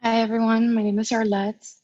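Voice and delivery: normal talking voice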